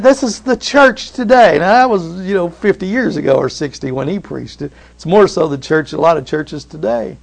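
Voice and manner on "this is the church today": higher, pointed preaching line; a touch of the quoted preacher; preacher's punchline. Retold or imitated. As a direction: land the punchline as the old preacher. Punchline of Ravenhill story.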